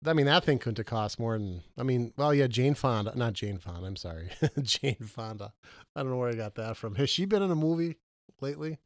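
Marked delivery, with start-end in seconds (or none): 4.5-5.5 laughing at slip. Real, not acted. Laughs at own name slip.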